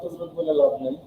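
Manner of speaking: normal talking voice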